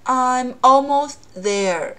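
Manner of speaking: almost there with the t held, not released, slowly; slow incomplete plosion demo; unreleased t, slow. Shown as a demonstration. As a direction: shape the t but don't let it out. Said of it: "不完全爆破".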